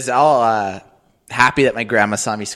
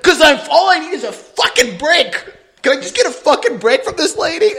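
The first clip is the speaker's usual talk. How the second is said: put-on high, louder kid tantrum; mock worked-up rant; 13 semitones higher, 5 dB louder than usual. Worked-up kid begs dad for a break, high mock tantrum. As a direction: plead and rage like a fed-up child. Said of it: "All worked up".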